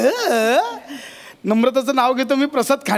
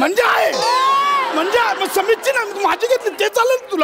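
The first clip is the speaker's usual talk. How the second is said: angry accusation; 9 semitones higher, faster than usual. Acted. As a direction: confront her with rising anger. Husband's jealous outburst.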